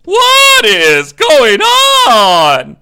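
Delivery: slow drawn-out shouted cry; exaggerated slow-motion yell of disbelief; quoted shout. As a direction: belt it out like a stunned reality-show guy. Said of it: "to quote the Joe Schmo Show".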